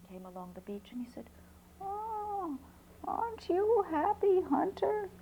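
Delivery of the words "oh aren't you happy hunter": put-on old man. Old man greets the hunter.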